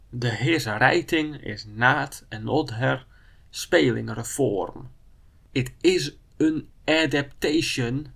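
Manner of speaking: slow, careful reading by Dutch sounds; Dutch spelling read. Shown as a demonstration. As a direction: read every word as Dutch spelling gives it, slowly. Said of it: "I'm gonna just pronounce it as written".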